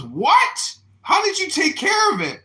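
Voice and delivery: high, loud acted disbelief; incredulous shout. Drill sergeant questions recruit's fix, shouting incredulously. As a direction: yell it like a stunned sergeant.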